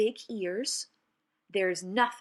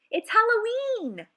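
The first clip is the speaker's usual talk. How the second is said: high, loud gleeful exclamation; excited shout; 13 semitones higher, 6 dB louder than usual. Acted. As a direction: burst out with Halloween glee. Excited opening of the poem.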